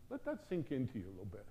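ordinary talk, nothing marked

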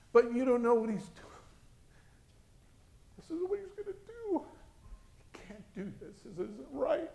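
very high, straining mother voice; anguished protest. Retold or imitated. Mother objecting to the voice about her child, high-pitched protest. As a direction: plead upward, pitched far above normal.

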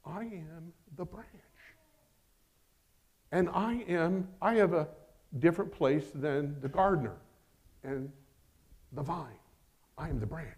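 softly, saying it to yourself; a hushed private affirmation; quiet self-affirmation. Shown as a demonstration. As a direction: speak it inward like a quiet vow. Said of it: "Say that to yourself".

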